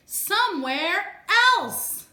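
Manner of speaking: loud, slow, drawn-out plea; exasperated outburst. Acted. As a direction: lean hard on both words, fed up. Gerald exasperated.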